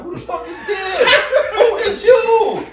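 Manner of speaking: very high, slow laughing squeal; shocked squeal. Retold or imitated. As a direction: squeal in comic disbelief. Shocked at side girl calling.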